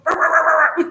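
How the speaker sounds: loud, high mock-angry sputter; Donald Duck tantrum. Imitating her shaking, mad twin, Donald Duck tantrum noise. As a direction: burst into a cartoon duck's furious spluttering. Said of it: "This looks like Donald Duck".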